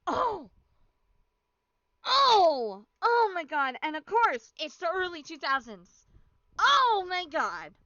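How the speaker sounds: loud real outrage, drawn out; outraged. Real, not acted. Gamer reacting to the betrayal, loud outrage. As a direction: exclaim loudly in disbelief.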